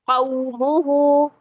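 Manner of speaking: कौमुहु with lips rounded into a tight beak; rounded waw leen. Shown as a demonstration. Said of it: "कैसे?". (होंठ गोल करके कौमुहु)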